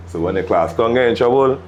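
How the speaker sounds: ordinary delivery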